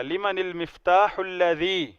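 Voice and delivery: لمن المفتاح الذی linked slowly, the alif of الذی silent; joined Arabic reading. Shown as a demonstration. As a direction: read slowly, gliding into lladhi. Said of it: "تو اس کو نہیں پڑھا جائے گا".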